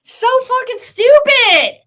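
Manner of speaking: loud, high, slow furious snap; real exasperated yell; angry outburst. Real, not acted. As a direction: spit out the contempt.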